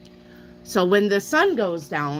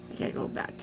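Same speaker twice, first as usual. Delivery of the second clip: whisper; 11 dB quieter, faster than usual. Real, not acted. Reads sister's tapestry quietly.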